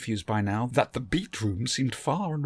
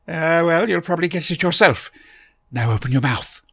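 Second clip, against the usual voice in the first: put-on higher and louder, fussy old gentleman; eccentric Doctor voice; 7 semitones higher, 9 dB louder than usual. A narrator's reading. The Doctor greets Barbara from behind, a higher, louder fussy old gentleman. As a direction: bustle in as a fussy, kindly eccentric.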